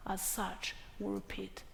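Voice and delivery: trails off into a near-whisper; hushed ending. Real, not acted. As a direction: let the last words fade to breath. Grave point about not repeating genocide.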